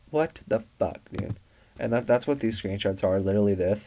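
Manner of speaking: ordinary talk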